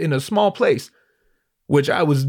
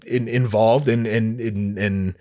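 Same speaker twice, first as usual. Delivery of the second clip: ordinary delivery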